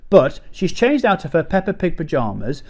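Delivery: usual speaking voice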